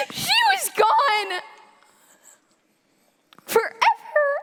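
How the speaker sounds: high, anguished crying out; tearful anguish to God; tragic heroine sorrow. Acted.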